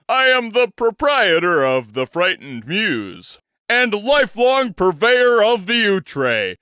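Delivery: a pompous stage gentleman's introduction; grand old showman. Acted. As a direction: present yourself like a theatrical impresario. Bostwick introduces himself grandly.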